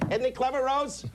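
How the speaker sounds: high, mock-impressed fan; admiring audience member. TV viewer to Rose about the comedian, high mock admiration. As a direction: marvel to your companion in a high voice. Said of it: "they all go".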